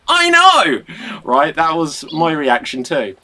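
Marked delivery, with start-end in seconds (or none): 0.1-0.8 high, loud excited cry; bursting with excitement. Real, not acted. Host shares the excitement.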